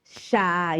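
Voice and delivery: ordinary talk, nothing marked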